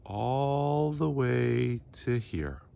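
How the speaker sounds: ALL THE WAY stretched long, rising high, then dropping on "here"; drawn-out emphasis. Real, not acted. Vlogger showing how big the woodpile was, drawn-out emphasis. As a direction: drag out "all the way" to show the distance.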